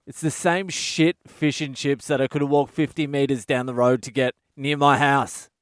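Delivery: ordinary talk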